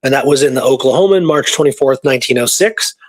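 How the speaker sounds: ordinary talk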